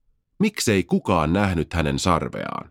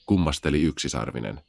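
plain reading voice

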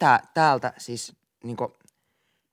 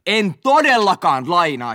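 higher, louder and slow shouted refusal; full shout; 7 semitones higher, 8 dB louder, slower than usual. Retold or imitated. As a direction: yell the refusal at full force. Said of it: "Sit mä huudan ihan täysillä".

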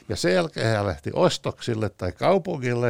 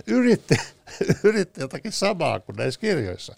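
laughing; 7 semitones higher, 3 dB louder than usual. Real, not acted. Amused telling of [name] anecdote.

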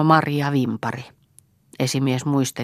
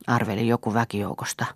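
ordinary delivery

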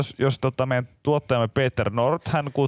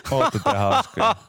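slow, laughing and higher; 8 semitones higher, slower than usual. Real, not acted. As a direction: tease them through a laugh. Laughing reply.

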